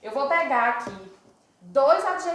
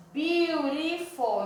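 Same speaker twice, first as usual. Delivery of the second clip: Beautiful drawn out slowly, syllable by syllable; slow model word; 3 semitones higher, much slower than usual. Shown as a demonstration. Teacher models Beautiful slowly for students, drawn out. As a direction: stretch Beautiful slowly for the class to split it. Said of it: "Então, vamos dividir aqui".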